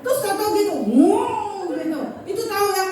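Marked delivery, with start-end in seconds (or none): 0.9-1.7 high, drawn-out yelp of alarm; acted jolt of surprise; startled cry. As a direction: cry out as the bump hits. Jolted by an unseen bump.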